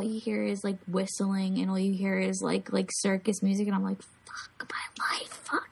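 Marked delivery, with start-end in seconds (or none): 4.0-5.7 slow, breathy whispered curse; horrified whisper. Retold or imitated. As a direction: whisper it in dread, drawn out. Dreading the maze in retold story.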